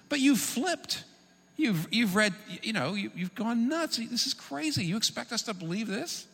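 high, incredulous scoffing voice, all disbelief; mocking paraphrase. The pagan governor scoffing at Paul, high and incredulous. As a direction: scoff at him like he's lost it. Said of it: "a little more accurate translation".

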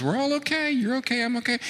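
high, airy mocking singsong; mock narcissist.

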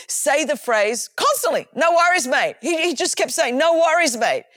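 usual speaking voice

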